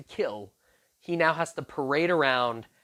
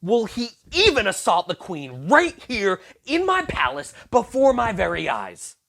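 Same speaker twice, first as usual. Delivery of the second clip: higher, louder furious cry; the king bursts out in shock; outraged exclamation; 5 semitones higher, 6 dB louder than usual. Acted. King catching Haman on the queen, furious outcry. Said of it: "The king exclaimed".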